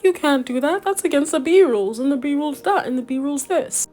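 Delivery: mocking nag. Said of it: "He's like".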